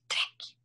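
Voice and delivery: whispered tearing noise; ripping sound. Retold or imitated. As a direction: make the sound of paper being ripped. Contract torn up.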